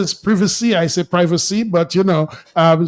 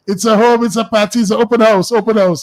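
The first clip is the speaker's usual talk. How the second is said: excited; 5 semitones higher, 5 dB louder than usual. Real, not acted. Host hyping an open house.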